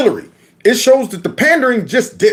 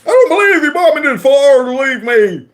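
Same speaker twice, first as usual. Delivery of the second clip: mock preacher line; 8 semitones higher than usual. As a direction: belt it out like a sarcastic preacher. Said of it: "this is pathetic".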